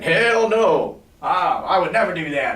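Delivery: loud, high mocking no way; mock refusal. Prospect scoffing at the salesman's offer, loud mocking no. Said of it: "they're gonna be like".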